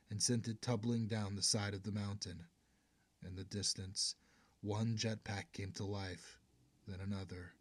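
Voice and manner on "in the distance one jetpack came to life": ordinary delivery, nothing marked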